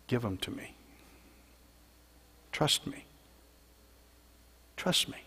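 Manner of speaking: soft, hushed and tender; almost a whisper, coaxing trust; gentle reassurance. Acted. Jesus reassuring the grieving, soft tender voice.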